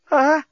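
short, high, loud "ah"; passionate moan. Acted. Lucy moaning to the journalist, passionate cry.

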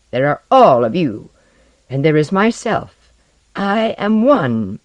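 loud, slowing to a drawn-out finish; bitter declaration. Acted. Osden sets himself against all humans, bitterly emphatic. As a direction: set yourself apart, forcefully.

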